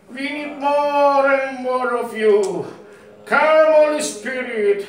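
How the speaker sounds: impassioned slow worship invocation; fervent prayer. Real, not acted. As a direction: call the Spirit down with drawn-out words. Fervent worship prayer calling on Spirit.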